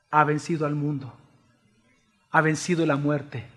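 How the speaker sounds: low, soft voice with weighty pauses; hushed, solemn preaching. Real, not acted. Preacher reveals to the church who the Lamb is, solemn hush. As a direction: quietly let the revelation settle.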